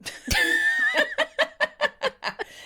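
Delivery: high, long cackle of laughter; burst of laughter. Real, not acted. Co-host cracks up at the sales team jibe, laughing. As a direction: crack up helplessly at the joke.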